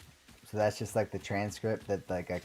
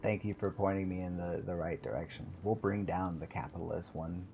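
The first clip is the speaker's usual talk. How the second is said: ordinary delivery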